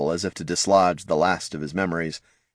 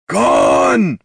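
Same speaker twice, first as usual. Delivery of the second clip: very high, loud troll bellow; panicked bellow; 8 dB louder than usual. A narrator's reading. Troll running in panicked circles, loud high bellow. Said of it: "bellowed Thane".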